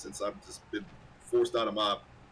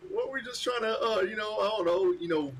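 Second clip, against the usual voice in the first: much higher, silly clueless voice; goofy anime hero; 9 semitones higher than usual. Retold or imitated. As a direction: play a dim cartoon hero. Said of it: "Like, what are you".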